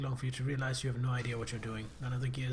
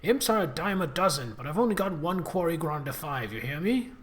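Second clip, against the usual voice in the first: yelling; 6 dB louder than usual. Acted. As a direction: bellow it at the idiot. Boss yells about his grinder.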